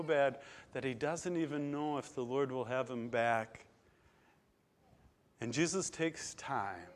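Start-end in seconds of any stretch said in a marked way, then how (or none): none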